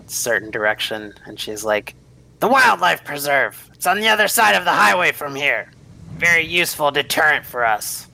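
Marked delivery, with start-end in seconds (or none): 2.4-8.1 higher, louder character voice; Sherry. Acted. Woman explains her guard animal to visitors, high character voice. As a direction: play Sherry, pitched up and projecting.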